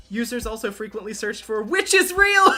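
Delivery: rising from high reading to a very loud shriek; incredulous delight. Real, not acted. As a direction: build to a high shout, breaking into laughter. Reads search result, building to laughter.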